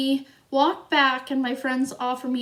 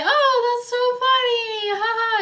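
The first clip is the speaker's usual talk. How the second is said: Hannah laughing it off; 10 semitones higher than usual. Retold or imitated. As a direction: play her as oblivious and amused. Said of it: "And like".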